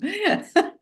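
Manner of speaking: a sudden high-pitched laugh; high burst of laughter. Real, not acted. Laughing at host's compliment.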